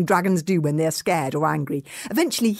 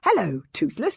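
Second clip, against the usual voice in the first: ordinary delivery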